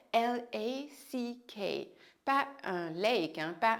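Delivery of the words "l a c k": letters spelled out slowly one by one. Shown as a demonstration. Teacher spelling a word for students, slow.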